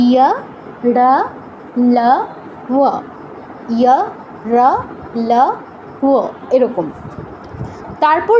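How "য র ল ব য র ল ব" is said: ইয়া, রা, লা, ওয়া as separate Sanskrit syllables ya, ra, la, va; semivowel demo. Shown as a demonstration. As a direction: recite ইয়া, রা, লা, ওয়া one by one with gaps. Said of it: "অন্তস্থ বর্ণগুলো আমরা কি কি পাচ্ছি".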